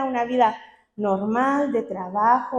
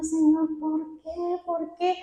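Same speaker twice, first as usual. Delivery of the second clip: mock tearful plea, high and soft; retold crying; 4 semitones higher, 5 dB quieter than usual. Praying in tears over her hair loss, re-enacted crying. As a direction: re-enact a small, high, weepy lament. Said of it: "me puse a llorar".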